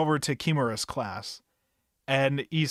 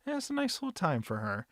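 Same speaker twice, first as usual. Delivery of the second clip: ordinary delivery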